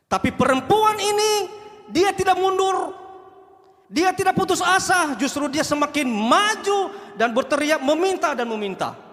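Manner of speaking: high, slow, loud preaching build; fiery sermon climax; fervent preaching. Acted. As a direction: lift the congregation, slowly rising. Preacher rising to full force.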